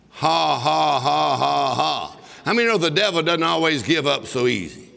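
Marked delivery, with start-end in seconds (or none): 0.1-2.2 deliberate ha-ha sinking in pitch; forced laugh. Retold or imitated. Preacher acts out laughing back at the devil, forced laugh. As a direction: imitate a man making himself laugh. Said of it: "he continued laughing".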